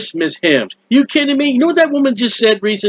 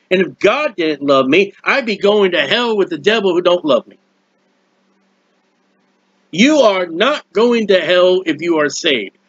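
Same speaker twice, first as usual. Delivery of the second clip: usual speaking voice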